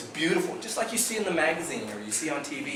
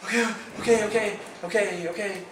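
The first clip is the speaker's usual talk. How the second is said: nervous okays; 8 semitones higher than usual. Retold or imitated. Nervous agreement to instructions.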